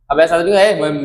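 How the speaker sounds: ordinary talk, nothing marked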